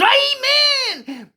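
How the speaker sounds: shrieked very high and loud, a jabbed cry; pained yelp. Acted. Little boy poked by grandma's needle, shrieking. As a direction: yelp it like you've just been stabbed in the butt.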